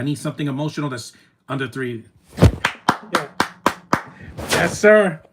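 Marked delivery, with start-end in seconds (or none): 2.4-5.2 loud, high hyped cheer; excited cheer. Real, not acted. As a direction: whoop it out. Cheering at the screen.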